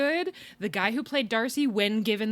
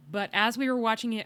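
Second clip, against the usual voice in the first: usual speaking voice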